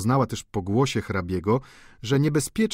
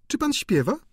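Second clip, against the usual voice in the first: high, bright sudden question; countess voice. A narrator's reading. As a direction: switch topics lightly. Countess asks Eugene.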